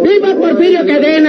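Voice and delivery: toast him at the top of your voice; drunken cheer. Acted. Drunk Lino toasting Porfirio Cadena, rowdy shout.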